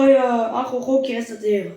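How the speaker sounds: normal talking voice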